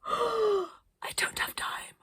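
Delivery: very high, slow whispered wail; mock anguish. Arcadia laments to the children, slow high whispered wail. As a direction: wail it like tragedy. Arcadia clasps head in despair.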